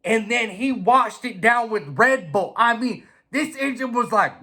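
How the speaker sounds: ordinary talk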